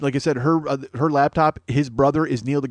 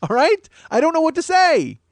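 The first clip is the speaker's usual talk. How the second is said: high, loud and fed up, much higher than usual; exasperated yell; 9 dB louder than usual. Retold or imitated. To Lily who can't find the files, a high exasperated yell. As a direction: throw up your hands and yell it out.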